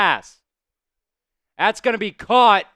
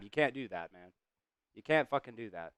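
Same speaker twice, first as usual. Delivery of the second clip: quiet grumble; 9 semitones lower, 14 dB quieter, faster than usual. Real, not acted. Frustrated about a holding penalty.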